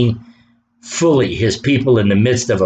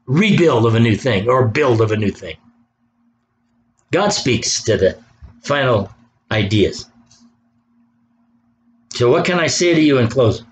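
normal talking voice

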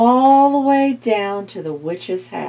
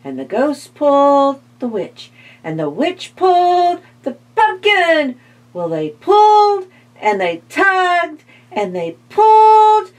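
building excitement; 10 semitones higher, 5 dB louder than usual. A narrator's reading. Climax of the big pull.

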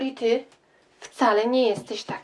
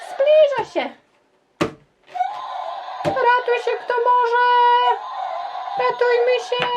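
high, loud frightened cries; much higher, loud and fast alarm calls; panicked shouting; 13 semitones higher, 4 dB louder than usual. Acted. Dinosaurs flee the T-Rex.

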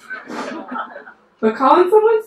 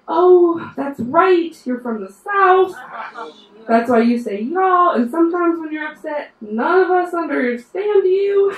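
higher, louder mock-knowing coworker voice; higher and louder, lightly mocking coworker; teasing coworkers; 7 semitones higher, 4 dB louder than usual. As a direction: imitate coworkers realizing she's Southern. Coworkers teasing about Southern roots.

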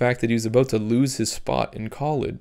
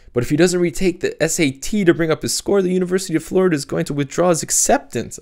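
higher, fast worried exclamation; exclaiming; 6 semitones higher than usual. Acted. Cap fretting to Sophie about the show, fast high exclamation. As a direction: blurt it out in alarm. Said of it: "I exclaimed".

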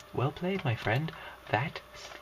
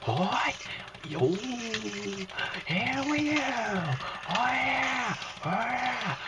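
triumphant whoops; 11 semitones higher, slower than usual. Real, not acted. To a monster while fighting it, triumphant high whoops. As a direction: whoop while beating the spider.

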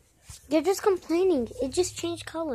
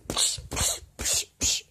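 sharp unvoiced psh noises, repeated; breathy hissing psh-psh; hissing psh sounds. Real, not acted. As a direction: shoo it away with hisses.

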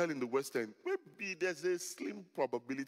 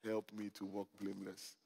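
low, hushed pleading prayer; begging God; 7 semitones lower, 11 dB quieter than usual. Retold or imitated. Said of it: "I was begging God".

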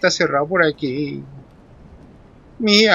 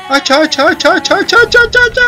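getting higher and louder each hacha; frantic rising shout; 12 semitones higher, 7 dB louder, faster than usual. Real, not acted. Gamer hacking at the monster, shouting higher and higher. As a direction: chant it faster and more frantic. Frantically swinging axe at enemy.

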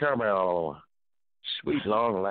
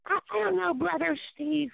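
high mock female voice; wife asking who is calling; imitating wife. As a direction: ask puzzled in her high voice. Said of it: "wife voiced high".

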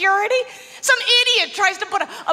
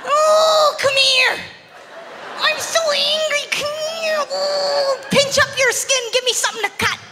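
mock nail clipper attacker; 6 semitones higher, much slower than usual. Comic plays a clipper-wielding attacker, hammed-up and high. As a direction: ham up a silly villain threatening with clippers. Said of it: "would you ever use a nail clipper as a weapon".

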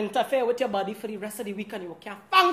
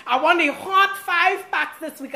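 eager demand; 5 semitones higher, 5 dB louder, slower than usual. Retold or imitated. Demanding hair packs from hairdresser.

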